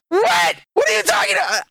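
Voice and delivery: very high, loud disbelieving shout; a sudden high shriek of disbelief; incredulous shout. Retold or imitated. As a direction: yell it in total disbelief. Retold disbelief at friend.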